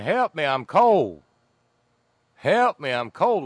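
put-on loud, drawn-out drunk Cajun wail; rough Cajun accent. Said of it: "I can't do the accent".

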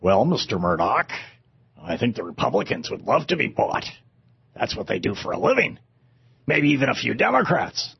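lightly voiced low, soft flunky; understated, low-key yes-man; advisor humoring the boss. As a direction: answer the tycoon quietly and deferentially. Advisor answering acted Murdoch.

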